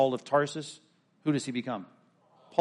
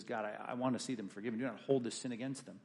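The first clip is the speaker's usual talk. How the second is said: much softer, barely above a breath of voice; hushed dying prayer; 3 semitones lower, 12 dB quieter, faster than usual. Acted. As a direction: let the last words fade as he dies. Stephen's last words, hushed.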